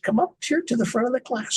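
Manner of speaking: high, quick teacher's order; lightly voiced teacher calling a pupil forward; teacher summoning pupil. Teacher calls boy to the front, lightly voiced summons.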